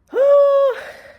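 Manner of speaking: high, loud exasperated wail; loud high groan. Real, not acted. Dreading the dentist, a loud high wail. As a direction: let out a long anguished groan.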